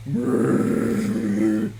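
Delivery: high grumbling ape noise; disgruntled grunt. Acted. Disgruntled ape creature grumbling at orders, high growl. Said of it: "He just goes".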